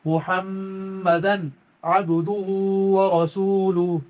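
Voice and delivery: slow chanted kalima, each word drawn out; slow Quranic recitation. Shown as a demonstration. Teacher recites the whole kalima for students, slow chant. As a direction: recite it like in the mosque, unhurried.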